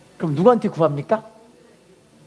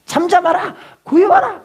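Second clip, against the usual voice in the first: high, loud mock rebuke; acting out an angry crowd's shout; scolding; 10 semitones higher, 6 dB louder than usual. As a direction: order them to shut up, sharply. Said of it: "막 꾸짖는 거예요".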